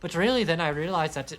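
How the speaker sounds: high put-on Scottish-Irish brogue; lilting mock Irish-Scottish accent. Shown as a demonstration. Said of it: "my Scottish is just a slow version".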